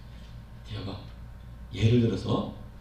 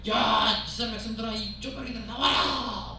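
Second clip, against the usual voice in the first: giant's taunt; 5 semitones higher than usual. Acted. Goliath calling out the Israelites, mocking and higher. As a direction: jeer at the puny army like a giant.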